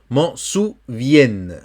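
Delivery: very slow "M'en souvienne", word ending on n; silent final E. Shown as a demonstration. Teacher reading the line for a learner, slow careful pronunciation. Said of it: "Le E ne se prononce pas".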